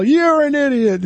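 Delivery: put-on high, slow drunken jeer; drunken yell. Drunk man yelling at the broker from the hall, high drunken jeer. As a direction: holler the insult like a sore drunk. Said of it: "yelling all the way down the hall".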